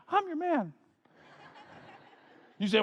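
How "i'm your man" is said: put-on high, squeaky fourteen-year-old; squeaky teenage voice. Shown as a demonstration. Fourteen-year-old David volunteering to fight, squeaky. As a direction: pitch it way up like a boy's changing voice. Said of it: "you get that squeaky voice".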